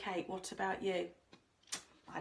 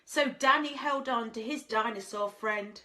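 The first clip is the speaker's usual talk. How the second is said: lilting rhymed storybook read; sing-song storyteller voice; reading to children; 5 semitones higher, slower than usual. A narrator's reading. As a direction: read it like a bedtime picture book.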